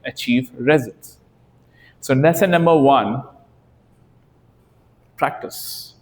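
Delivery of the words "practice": one breathy whispered word; hushed, unvoiced emphasis on practice. Real, not acted. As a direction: whisper it like a secret key. Lesson one, single-word punchline.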